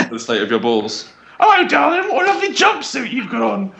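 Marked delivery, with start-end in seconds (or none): 1.4-3.7 high, hammy Cockney barmaid voice; overdone Cockney barmaid flirting; bad Cockney accent. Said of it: "saucy barmaids inquiring in bad Cockney accents".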